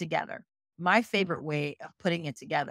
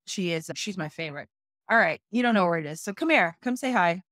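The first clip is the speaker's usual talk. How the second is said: normal talking voice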